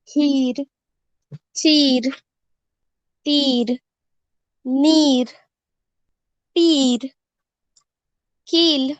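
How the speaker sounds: each word read with the long ई held. Shown as a demonstration. Teacher reading long ee words to young pupils. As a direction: drill the long ee in every word. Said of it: "इसका उच्चारण लंबा किया जाता है".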